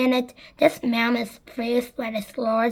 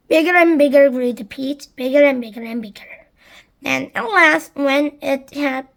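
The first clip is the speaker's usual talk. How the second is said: usual speaking voice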